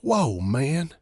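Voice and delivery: a touch of panting. A narrator's reading. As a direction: gasp it out after running. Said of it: "he panted".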